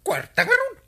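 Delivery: high, loud eager cry about cash; eager for money. Acted. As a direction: perk up at the thought of money. Husband wants the honorarium money. (naragsak a nangato a timek maipapan iti kwarta)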